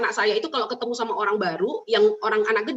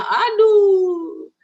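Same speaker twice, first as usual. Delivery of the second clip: high, loud dismayed cry of aduh; exasperated aduh; 9 semitones higher, 8 dB louder than usual. Real, not acted. Counsellor exclaiming at a child compared with the sibling, loud and high. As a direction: let aduh burst out in dismay.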